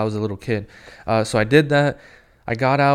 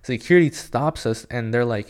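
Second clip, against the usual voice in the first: ordinary delivery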